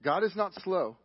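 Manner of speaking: ordinary talk, nothing marked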